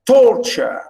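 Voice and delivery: "torture" with a loud first syllable; stress on first syllable. Shown as a demonstration. Teacher showing how we pronounce it, "torture" stressed at the start. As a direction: punch the front of "torture", drop the end. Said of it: "It's to do with pronunciation".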